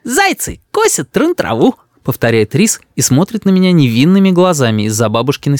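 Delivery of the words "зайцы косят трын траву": put-on high, slow little-boy voice; mock-innocent child. A narrator's reading. Boy repeats from behind grandmother, mock-innocent. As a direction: repeat it slowly, playing innocent.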